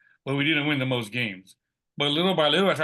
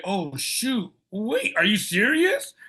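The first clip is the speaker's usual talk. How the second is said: higher, slowing into stunned disbelief; retold surprise; 8 semitones higher, much slower than usual. Just hired by the athletic directors, slowed higher disbelief. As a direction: let it dawn on you that you got the job.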